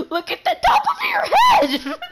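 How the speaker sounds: loud, high-pitched outburst; excited shout. Real, not acted. Shouts teasing remark between laughs.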